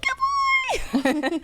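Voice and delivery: very high squeal of praise; shrieking high dog praise; squeaky girl voice. Retold or imitated. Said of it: "competition of who can get squeakier".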